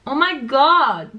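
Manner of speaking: "Oh my god" loud, slow and swooping up; stretched, over-excited "Oh my god"; exaggerated English zigzag. Shown as a demonstration. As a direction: belt out "Oh my god" with big pitch swings. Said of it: "il faut plus exagérer".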